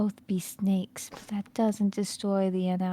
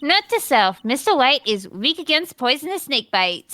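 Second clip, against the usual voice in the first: loud scheming villain; 5 semitones higher, 6 dB louder, faster than usual. Acted.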